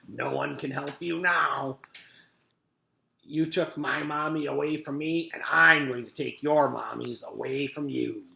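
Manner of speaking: mean villain's taunt; sneering. A narrator's reading. Mister Gorf taunts the children, sneering. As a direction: gloat over helpless victims. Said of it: "he sneered".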